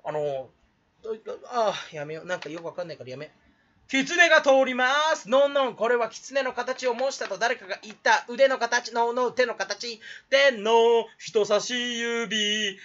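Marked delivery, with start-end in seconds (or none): 3.9-12.8 slow, louder, grandly announcing voice; hammed-up slow narrator explaining the fox hand; mock documentary narration. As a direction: narrate the hand pose like a nature film.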